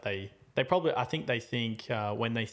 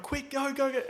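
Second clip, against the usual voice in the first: high-pitched retold urging to go; urgent urging; 14 semitones higher than usual. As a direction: act out a hurried, urgent command. Retelling urging driver to hurry out.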